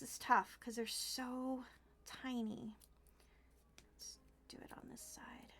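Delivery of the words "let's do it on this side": hushed whisper to herself. Real, not acted. Streamer murmuring to herself mid-cut, whispered. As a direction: breathe the words, focused on the cut.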